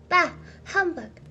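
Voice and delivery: loud, irritable scoff; irritated. A narrator's reading. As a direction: wave it away crossly. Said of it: "replied in an irritated manner".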